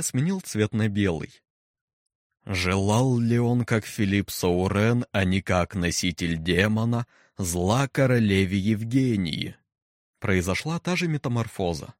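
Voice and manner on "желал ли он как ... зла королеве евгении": slow, booming, impassive question; menacing deep voice. Acted. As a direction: let the words fall into silence. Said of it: "глубокий, низкий, бесстрастный".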